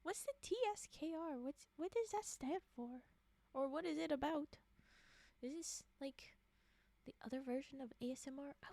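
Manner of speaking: loud, high, broken stammer of I's; frantic stammering. Pretend. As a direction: stutter in a panic, half crying.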